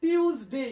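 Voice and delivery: টিউজডে as "tyooz-day", with a y glide; loud, careful model of টিউজডে with a z sound; careful pronunciation. Shown as a demonstration. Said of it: "আমি ভালো করে বলি".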